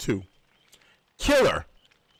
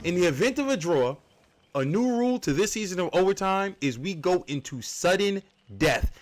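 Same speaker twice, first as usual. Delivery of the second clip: usual speaking voice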